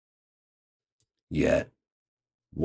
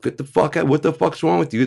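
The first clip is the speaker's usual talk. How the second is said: high furious shout at the agents; dad shouting at agents in his yard, furious; angry dad yelling; 10 semitones higher, 7 dB louder than usual. Retold or imitated. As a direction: roar it like an outraged father.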